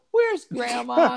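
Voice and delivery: put-on high, slow child's "Where's Grandma?"; lightly voiced preschooler asking for grandma; small child asking. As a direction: ask it like a little kid tugging a pant leg. Little kids asking for grandma.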